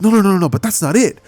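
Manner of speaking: talking fast over his mom; eager interruption. Acted. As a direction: cut her off in a hurry. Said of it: "Izuku stops her and goes".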